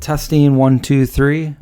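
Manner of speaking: tinny mic check with metallic ring; tin can sound. Real, not acted. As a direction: test the mic through a tinny filter. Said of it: "Sounds like a tin can".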